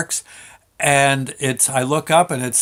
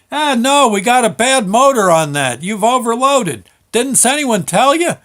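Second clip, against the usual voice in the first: foreman yelling; 9 semitones higher, 6 dB louder than usual. Retold or imitated. Foreman shouting explanation.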